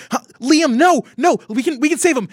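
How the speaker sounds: high, loud, frantic pleading over a dying friend; desperate screaming. Acted. Greta screaming over Liam's crumbling body, desperate. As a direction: scream for him not to die. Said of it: "She's screaming".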